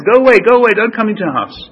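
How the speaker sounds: loud, high-pitched shooing away; acted angry shout. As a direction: shout an unwelcome visitor off the doorstep. Acted shouting as door is slammed.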